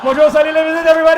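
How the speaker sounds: loud, high hype call to the crowd; big announcer shout; shouted announcement. Real, not acted. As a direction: pump up the room.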